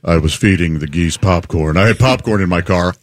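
low, mumbly impression of a guilty colleague; sheepish confessing coworker. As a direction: drop the voice way down and own up haltingly. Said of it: "and he said".

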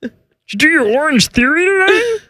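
high, strained puppet-frog voice; Kermit. Acted. Kermit asking his co-host about her workout, high and strained. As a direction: chat in a squeezed, high frog voice. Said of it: "do you like the Kermit voice".

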